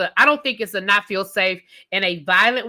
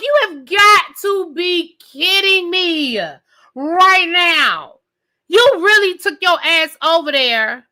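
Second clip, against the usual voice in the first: higher, slow, stretched-out "you have got to be kidding me"; incredulous disbelief; 5 semitones higher, 3 dB louder, slower than usual. Retold or imitated. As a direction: drag it out in stunned disbelief. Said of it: "I was like".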